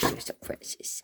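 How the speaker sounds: soft whisper to the dog; whispered praise. Real, not acted. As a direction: hush it tenderly to her.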